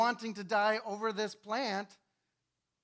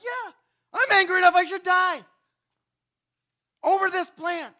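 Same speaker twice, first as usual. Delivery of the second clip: much higher and louder, seething; angry shout; 11 semitones higher, 13 dB louder than usual. Acted. Jonah shouting back at God about the plant, angry. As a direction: bellow it, angry enough to die.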